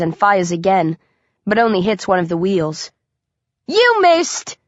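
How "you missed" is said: high-pitched jeering hoot; mocking hoot. A narrator's reading. Hunter jeering at his friend's missed shot, hooting high. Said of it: "Coley hoots".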